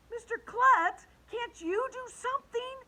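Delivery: put-on high-pitched, urgent girl's appeal; pleading child. A narrator's reading. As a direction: call out for help like a distressed kid. Upset girl appeals to principal.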